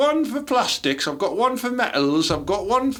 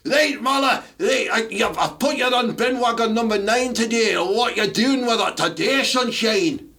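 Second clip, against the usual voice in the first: put-on Scottish accent; 4 semitones higher than usual. Playing the bin gaffer quizzing his lad, in a hammed-up Scottish accent. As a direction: bark it like a Scottish foreman. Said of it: "Can I be Scottish?".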